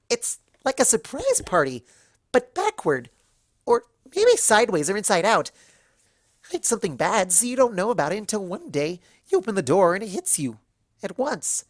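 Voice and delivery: bouncy, puzzled chatter; Pinkie Pie. A narrator's reading. Pinkie Pie musing to her friends, high and bubbly. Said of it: "Pinkie Pie scratched her head".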